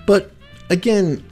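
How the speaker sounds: ordinary talk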